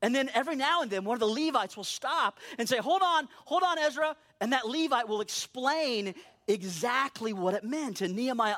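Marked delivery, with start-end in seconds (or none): 2.8-4.2 raised, higher interjection; calling out to interrupt. Acted. Levite halting Ezra as he reads, calling out to interrupt. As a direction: cut in on the reader, pitched up.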